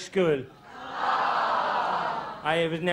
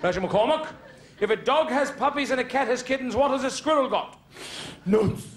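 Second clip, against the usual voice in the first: hammed-up classroom scene; teacher and pupils; 8 semitones higher than usual. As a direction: bark teacher lines, chirp the answers.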